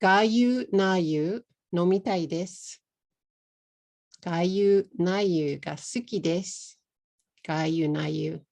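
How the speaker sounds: "Gayu nayu" with a vowel pushed into each syllable, ga-yu na-yu; "Gayu nayu" said in four beats instead of two; extra vowels added. Shown as a demonstration. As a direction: mangle gyunyu like a foreigner adding vowels. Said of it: "Here's another one. Listen".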